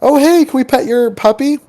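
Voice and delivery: high, friendly request; a light high voice asking to pet a puppy; sweet polite asking. Retold or imitated. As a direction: ask nicely with a lift. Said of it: "we're like".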